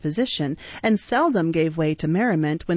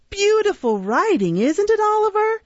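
Charlie Bates; 14 semitones higher, 4 dB louder than usual. Acted. Charlie taunts Oliver.

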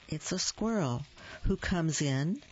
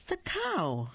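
high reveal; 9 semitones higher than usual. A narrator's reading. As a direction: jump up high on the cow. Dramatic reveal of next animal.

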